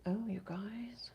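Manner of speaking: slow soft whisper. Real, not acted. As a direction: whisper it slowly to chat. Aside to chat while painting.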